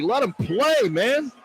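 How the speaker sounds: normal talking voice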